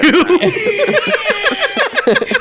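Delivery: high, long laugh cracking up; helpless laughing fit; real burst of laughter. Real, not acted. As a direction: break into loud high laughter. Laughing at a friend's joke.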